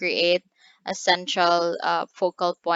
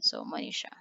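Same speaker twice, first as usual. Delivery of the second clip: quick whispered aside to a student; 9 dB quieter, faster than usual. Real, not acted. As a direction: drop to a whisper, naming her.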